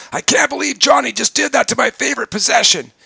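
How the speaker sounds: high, loud frustrated outburst; upset person venting. Shown as a demonstration. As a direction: vent about Johnny at full pitch. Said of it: "somebody walks in the room and says".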